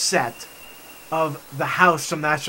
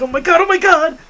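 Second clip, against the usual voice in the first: loud, high mock panic; freaking out; 11 semitones higher, 8 dB louder than usual. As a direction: imitate friends panicking at full pitch. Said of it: "they all kept freaking out".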